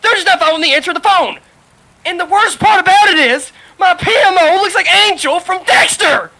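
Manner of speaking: loud, high, furious venting; heated rant. Real, not acted. Telling friends about the unfair write-up, loud angry rant. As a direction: build it into an outraged rant. Ranting about supervisor, rising.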